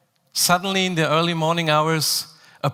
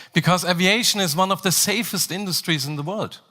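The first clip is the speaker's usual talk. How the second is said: ordinary talk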